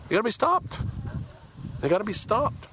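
anger spilling over about the militants. Real, not acted. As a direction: demand it, voice rising hard. Outburst against militants.

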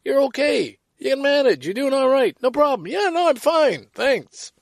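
put-on upbeat "I'm fine" to hide the hurt; brave front. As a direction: brush it all off brightly. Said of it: "Not just stiff upper lip".

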